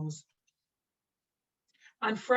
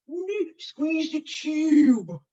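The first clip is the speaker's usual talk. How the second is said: mock fuss; 6 semitones higher, 7 dB louder, much slower than usual. Teacher voices a mock quote.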